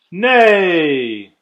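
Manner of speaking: the guests all yell nay at Thidwick, loud and long; group yell. Acted. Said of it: "they all yelled".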